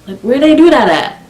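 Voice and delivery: high-pitched, emphatic incredulous question; exclaimed disbelief. Real, not acted. Storyteller repeating her question about friend, loudly incredulous. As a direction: push the pitch right up, baffled.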